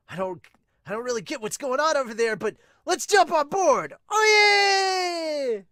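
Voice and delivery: rising from puzzled to a loud, high cheer; building hype to a yell. Acted. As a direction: get swept up and shout yeah. Joining in the hype.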